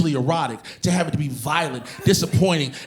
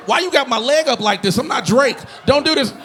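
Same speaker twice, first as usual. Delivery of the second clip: high-pitched protest; 9 semitones higher than usual. Retold or imitated. Protesting the leg stretch.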